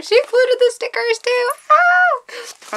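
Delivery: high, giddy squeal of delight; excited squeal. Real, not acted. Vlogger finding bonus stickers, excited squeal.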